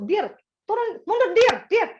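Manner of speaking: high, insistent parking shouts; impatient call. Retold or imitated. Husband guiding wife's parking, high and impatient. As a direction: pitch it up and press the driver. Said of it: "nggak sabar gitu ya".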